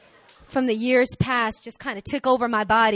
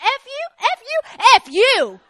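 high, loud hammed-up comeback; shouted curses, acted; 13 semitones higher, 9 dB louder than usual. Woman back up the stairs cursing him back, loud and indignant. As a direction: hurl it back, building with each repeat. Acts out yelling back at stranger.

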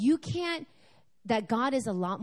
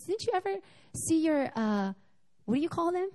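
office lady; 8 semitones higher than usual. Retold or imitated. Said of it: "She goes".

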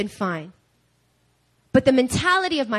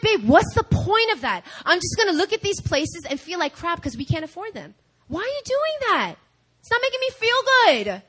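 high-pitched exasperated complaint, retold; exasperated protest; 6 semitones higher than usual. Wife objecting to husband's over-budget apartment hunting, exasperated. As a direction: whine the protest at a partner, mock-pleading.

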